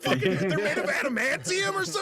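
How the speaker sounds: high-pitched, laughing incredulity; laughing disbelief. Real, not acted. Joking disbelief amid table laughter.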